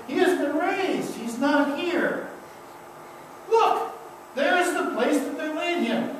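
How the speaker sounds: high, lightly voiced announcement; young man at the tomb telling Mary, lightly voiced; a hint of a proclaiming angel. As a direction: announce the empty tomb with rising joy. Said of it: "he has a message for her".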